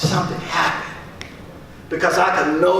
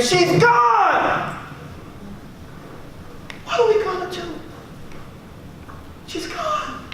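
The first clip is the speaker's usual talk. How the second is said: high anguished cry as the panicking caller; much higher, panicked wail of grief; retold scream; 9 semitones higher, 4 dB quieter, slower than usual. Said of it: "was screaming".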